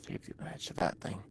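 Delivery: hushed whisper to himself. Real, not acted. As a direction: breathe the words almost silently. Whispers to self while playing.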